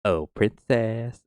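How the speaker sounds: slow, drawn-out "princess". Acted. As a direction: stretch out "princess" like a doting defender.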